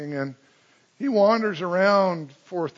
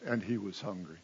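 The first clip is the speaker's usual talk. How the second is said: soft, flat dry delivery; underplay it for irony; dry understatement; 8 semitones lower, 14 dB quieter than usual. Shown as a demonstration. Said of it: "understatement of the gospel writers".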